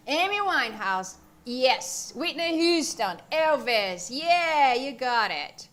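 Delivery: loud, delighted agreement; excited approval. Real, not acted. Teacher cheering on students' singer suggestions, excited.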